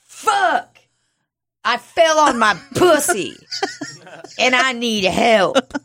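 high, hammed-up plea for help; distressed caller. Injured woman calling the law line, hammed-up high distress. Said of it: "Me calling that place".

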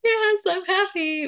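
high, slow, put-on happiness; drawn-out, high-pitched fake cheer; pretend happy. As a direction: imitate yourself faking joy for the crowd. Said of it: "Let me just lie".

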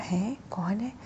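hushed, startled whisper; softly spooked question. Acted. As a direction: whisper it as if caught off guard by a voice. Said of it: "आनंद चौंक गया".

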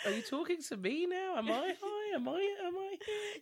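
high-pitched comic confusion; mock bewilderment. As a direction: play a baffled viewer, voice way up high. Acting out a bewildered viewer's thoughts.

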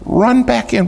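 fed-up squeal at the repeat; exasperated high pitch. Real, not acted. Third soaking, exasperated retelling.

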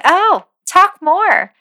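high, slow swooning fan voice; gushing fan. Retold or imitated. American fan begging an Australian to talk, high and gushing. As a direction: coo at the foreigner like a smitten fan. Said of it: "We're like".

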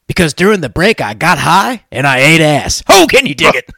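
loud hyped shout; excited. Acted. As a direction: build to a whooping high peak. Host's hyped punchline.

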